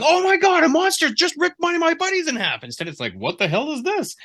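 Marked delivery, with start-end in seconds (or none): 0.0-2.6 much higher and louder, panicked; horrified cry. Acted. Mark seeing his buddy ripped in half, terrified. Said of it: "He would be thinking". 3.1-4.1 much higher, jokey sitcom delivery; mock punchline. Editor mocking the writer's line as a comic punchline. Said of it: "like a punchline from a film".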